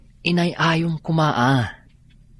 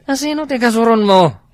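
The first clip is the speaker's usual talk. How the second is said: higher, louder challenging question; sharp demand; 9 semitones higher, 6 dB louder, faster than usual. Acted. Friend loudly demanding who he is listening to. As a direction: push him hard for an answer.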